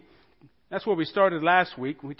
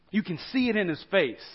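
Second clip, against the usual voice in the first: ordinary talk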